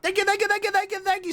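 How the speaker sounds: high chipmunk-like thanks, rattled off; high squeaky thank-you voice. Acted. High-pitched thank-you chant.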